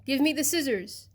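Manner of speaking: a hint of a tiny creature crying out; small crab voice. A narrator's reading. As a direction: call out like a newly shrunk little crab. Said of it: "crying in a very small voice".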